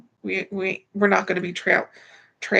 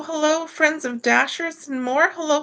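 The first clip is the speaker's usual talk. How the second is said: ordinary delivery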